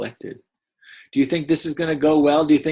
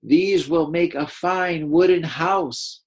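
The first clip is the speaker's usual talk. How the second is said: higher, slow character voice; Pimby's voice; 5 semitones higher, slower than usual. Shown as a demonstration. Teacher voicing Pimby for the class, higher and slow. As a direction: voice Pimby, proud of her find. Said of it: "like, her voice".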